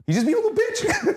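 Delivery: squeaky laughing jab. Real, not acted. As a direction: laugh it out high. Mocking Vader, laughing.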